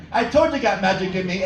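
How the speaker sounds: ordinary delivery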